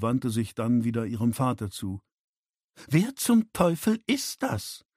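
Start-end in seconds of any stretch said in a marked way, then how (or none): 2.9-4.8 high-pitched, drawn-out outrage; slow, incredulous and hostile; indignant demand. A narrator's reading. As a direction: demand it as an affronted woman. Hostile daughter demands who stranger is.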